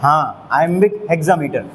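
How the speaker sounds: ordinary delivery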